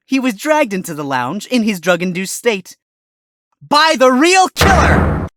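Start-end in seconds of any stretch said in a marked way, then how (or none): 3.6-5.3 shouted, slow and high, hitting every word; dramatic accusation. Acted. Defense lawyer names the real killer to the court, shouting. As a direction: slam the accusation home.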